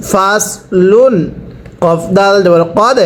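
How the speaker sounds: ordinary delivery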